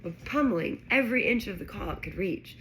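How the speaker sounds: usual speaking voice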